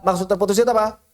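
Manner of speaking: normal talking voice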